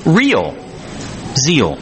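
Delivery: real, long pause, zeal, each falling; slow, deliberate, word by word; said slowly. Shown as a demonstration. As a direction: space the two words far apart. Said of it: "You gotta say that slowly".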